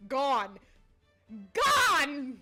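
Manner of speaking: ordinary talk, nothing marked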